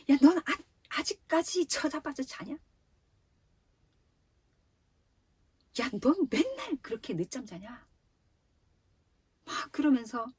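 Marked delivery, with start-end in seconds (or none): 0.1-7.9 high-pitched nagging mom, acted out; scolding mother. Mother bursts in on sleeping child, scolding. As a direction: imitate a mother laying into a late sleeper. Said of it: "막 쏘아붙였다고".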